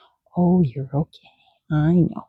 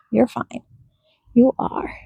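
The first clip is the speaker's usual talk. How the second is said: usual speaking voice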